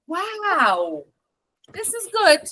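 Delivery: bright high excited praise; genuinely impressed wow. Shown as a demonstration. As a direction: sound truly impressed by it. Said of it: "So if I say".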